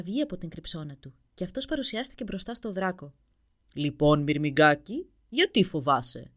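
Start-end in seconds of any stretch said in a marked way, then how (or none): 3.7-6.3 slow, booming stern giant; stern dragon. A narrator's reading. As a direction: question the ant-sized prince sternly. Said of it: "ρώτησε αυστηρά ο δράκος". (αυστηρή βροντερή φωνή δράκου)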